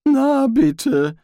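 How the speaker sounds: high, resigned told-you-so; grumbling Rincewind. A narrator's reading. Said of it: "brummte Rincewind".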